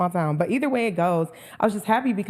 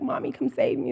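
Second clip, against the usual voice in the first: put-on childish plea; 6 semitones higher, 5 dB quieter than usual. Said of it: "and I'm like".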